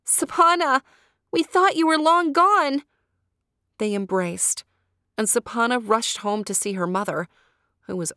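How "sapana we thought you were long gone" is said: high, loud joyful cry; overjoyed friends. A narrator's reading. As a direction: call out with relief and joy. Friends greet her joyfully.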